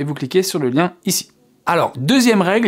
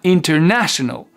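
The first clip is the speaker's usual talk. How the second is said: "International" with a higher "na"; higher stressed syllable. Shown as a demonstration. Teacher asking learners to try, "na" pitched higher. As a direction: make "na" the peak of "International". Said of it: "un peu plus aiguë sur le na".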